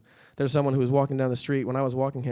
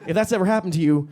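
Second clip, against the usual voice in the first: ordinary talk, nothing marked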